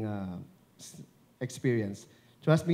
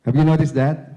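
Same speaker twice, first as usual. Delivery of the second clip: much louder, full projection into the mic; projected loud demo; 10 dB louder than usual. Shown as a demonstration. Coach demonstrating mic power to the audience, loud. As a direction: throw the voice out to the back row.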